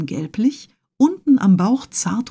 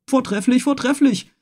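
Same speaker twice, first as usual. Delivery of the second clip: exclaiming; 5 semitones higher than usual. A narrator's reading. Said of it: "rief der Harpunier aus".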